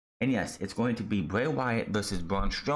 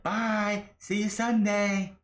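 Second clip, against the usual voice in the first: high, slow sing-song farewell; a much higher, slow mock goodbye to his rival; 12 semitones higher, much slower than usual. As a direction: wave him off in a much higher, teasing voice. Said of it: "Then he's like".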